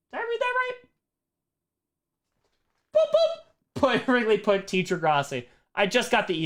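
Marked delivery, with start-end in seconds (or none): 0.1-0.7 squeaky, much higher, can't believe it; stunned disbelief. Real, not acted. Streamer reading a huge donation, squeaky shocked disbelief. 3.0-4.0 silly boop noise. Real, not acted. Silly noise after the donation.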